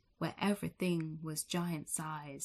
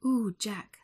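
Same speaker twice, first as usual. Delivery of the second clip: loud, higher delighted gasp; delighted mum; 3 semitones higher, 7 dB louder than usual. Acted. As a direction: gush with joy at her son.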